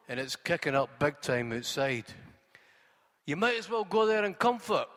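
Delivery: plain reading voice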